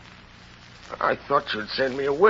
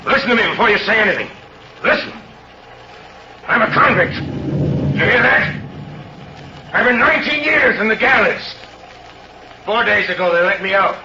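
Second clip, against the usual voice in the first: desperate outburst; 7 semitones higher than usual. Acted. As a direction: force it out before he can refuse you. Convict blurts confession to bishop.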